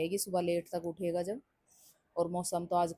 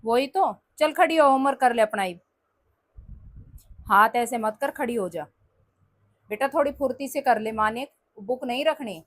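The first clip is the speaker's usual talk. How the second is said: loud, high, impatient scolding; scolding mother; 5 semitones higher, 7 dB louder than usual. Real, not acted. Mother loudly nagging kids to get up.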